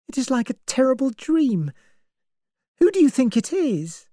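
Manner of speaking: put-on frightened young woman. A narrator's reading. Vera asks Lombard who the killer is, uneasy. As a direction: voice Vera as scared and unsettled.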